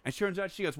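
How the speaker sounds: ordinary talk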